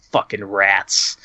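low, slow, fed-up mutter; deadpan grumble. Retold or imitated. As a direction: mutter it like a worn-out victim. Bloodied sheriff's deadpan answer.

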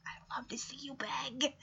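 smug, whispered gloat. Retold or imitated. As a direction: whisper it like a pleased villain. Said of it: "And Margot's like".

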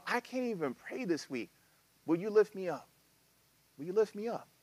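soft pleading prayer. Retold or imitated. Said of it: "and go".

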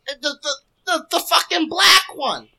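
high-pitched, loud mock grandma voice; imitated grandma. Grandma pointing out someone at the table, hammed-up screech. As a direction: screech it like an indignant grandma, drawing out the words. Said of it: "the grandma say".

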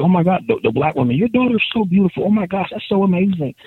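high gushing praise; gushing excited women. Retold or imitated. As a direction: rave about it like an excited fan. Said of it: "the women would be like".

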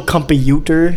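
"computer" drawn out in a Filipino accent; slow Filipino-accented "computer", sounded as spelled. Shown as a demonstration. Explaining Filipino spelling to friends, Filipino accent. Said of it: "say it in a Filipino accent".

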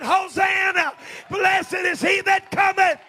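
high, jubilant praise cry; drawn-out preacher's hosanna; shouted acclamation. Acted. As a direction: shout it as a welcome to a king. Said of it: "we ought to be saying".

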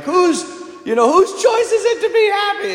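high mock shout of the dad's daily question. Dad calling out after his girls from the car, mock high shout. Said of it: "'cause he's like".

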